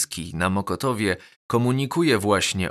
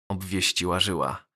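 ordinary delivery, nothing marked

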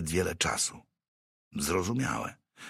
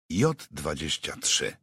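usual speaking voice

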